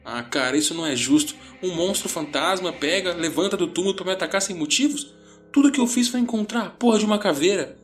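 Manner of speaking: high, agitated panicked rant; frenzied. A narrator's reading. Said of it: "Ele estava entrando em frenesi".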